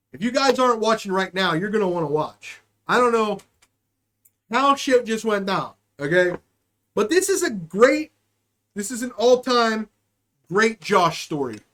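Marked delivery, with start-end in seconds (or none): none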